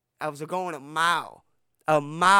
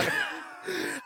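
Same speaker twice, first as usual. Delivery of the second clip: high breathy laugh; 12 semitones higher, 4 dB quieter than usual. Real, not acted. Laughs at own story.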